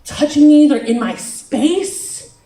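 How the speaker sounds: high, loud panicked complaint; whiny outburst, stretching the last word; mock freak-out. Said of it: "He started freaking out".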